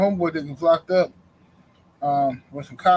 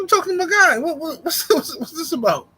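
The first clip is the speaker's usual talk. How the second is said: high incredulous voice; 12 semitones higher than usual. Retold or imitated. Himself on phone, retold.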